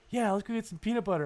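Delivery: high, fast and sugary; cheery coaxing. Retold or imitated. Owner coaxing his scared dog, high and sugary. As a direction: coax the dog with fake cheer. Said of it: "And then he's like".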